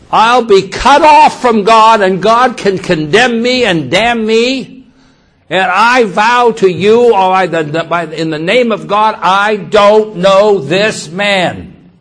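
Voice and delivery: loud, high, shouted oath; vehement sworn denial. Acted. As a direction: swear it forcefully, hammering each word. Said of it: "He was saying".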